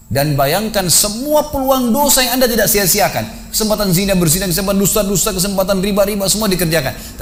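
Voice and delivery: high, insistent preaching voice rising over a list; impassioned preaching. Acted. Preacher confronting the mock corpse with his sins, impassioned exhortation. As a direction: press each sin home with mounting urgency.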